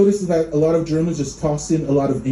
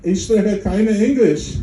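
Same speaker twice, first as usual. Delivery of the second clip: mock German accent; 5 semitones higher, slower than usual. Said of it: "And you're like".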